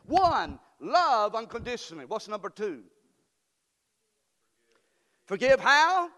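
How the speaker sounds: preacher drilling the congregation; call and response. Acted. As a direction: shout the question to the crowd. Preacher calling response from crowd.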